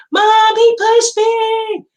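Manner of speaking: imitated child scream. Mom imitating her daughter screaming at her, loud wail. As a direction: wail it like a little girl tattling. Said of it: "Mackenzie screams".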